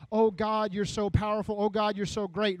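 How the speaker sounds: over-effusive mock reverence; gushing praise. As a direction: gush in mock awe. Mocks Mary's effusive praise.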